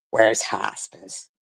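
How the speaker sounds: low, slow sneering repeat of "where's hospice?"; slow mocking drawl. Mocking a hater's comment, slow low sneer. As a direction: drag out "where's hospice?" with contempt. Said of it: "repeats the quote mockingly".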